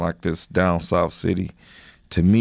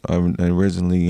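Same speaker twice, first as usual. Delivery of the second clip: ordinary talk, nothing marked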